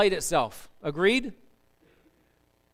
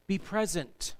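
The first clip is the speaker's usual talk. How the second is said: normal talking voice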